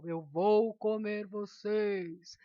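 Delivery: loud, slow, menacing wolf voice; big bad wolf. Shown as a demonstration. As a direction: stretch out the threat like a storybook wolf. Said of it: "E o lobo falou".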